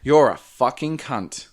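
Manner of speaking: slow, deliberate insult, each word weighted; meant to offend. Shown as a demonstration. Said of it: "you're meant to take offense at that".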